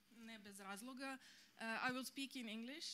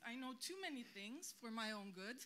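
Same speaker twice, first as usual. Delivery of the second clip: normal talking voice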